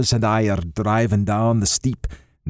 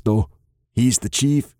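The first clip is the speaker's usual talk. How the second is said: ordinary delivery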